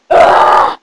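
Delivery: short rough yell; frustrated scream. Acted. Fairy annoyed by the child, frustrated yell. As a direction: let out a loud raspy "ah".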